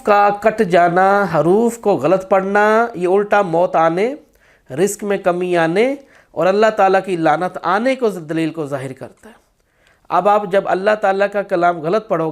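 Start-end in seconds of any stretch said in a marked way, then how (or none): none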